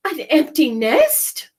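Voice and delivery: the chicken amazed; crying out. Acted. Chicken crying out at the news of a nest. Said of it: "cried the chicken".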